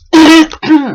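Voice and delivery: sudden loud cough; hacking cough from a sore throat. Real, not acted. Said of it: "Oh, pardon".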